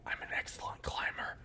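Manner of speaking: soft, secretive whisper; conspiratorial whisper. Acted. The kid whispering the secret deal to the prisoner.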